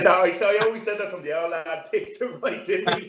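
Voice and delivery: high, laughing through words. Real, not acted. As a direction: chuckle while speaking. Laughing at the joke.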